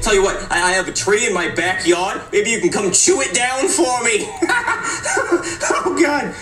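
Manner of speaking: teasing that tips into high cackles; cracking up at your own joke; laughing taunt. Acted. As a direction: keep heckling until you lose it laughing. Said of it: "Are you done?".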